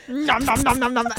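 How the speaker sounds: repeated "nom" munching noises; mock munching. McCoy gobbling his replicated corn, mock munching. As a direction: chomp through imaginary food in quick syllables.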